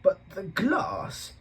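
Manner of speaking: high, slow, stunned zookeeper; shocked disbelief. Acted. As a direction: gasp it out in bewildered shock. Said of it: "in shock".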